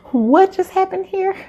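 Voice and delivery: high-pitched, baffled reaction; taken aback. Retold or imitated. Retold startled reaction to sudden crying.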